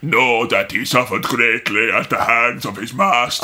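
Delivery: low Kissinger-like villain voice; Mouth of Sauron. Retold or imitated. Envoy on the suffering prisoner, low villain voice. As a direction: drop low for a gloating evil envoy. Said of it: "he's also got Henry Kissinger's voice".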